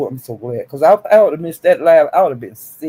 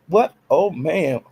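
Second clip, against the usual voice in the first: ordinary talk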